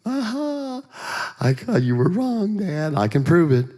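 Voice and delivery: put-on high, sing-out triumph of a kid; gleeful gotcha. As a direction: act like a child catching a parent out. Kid catching dad out, acted.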